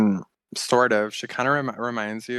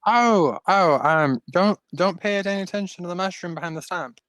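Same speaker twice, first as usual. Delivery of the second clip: flustered high voice; 6 semitones higher than usual. Acted. Trapped half-elf voiced by GM.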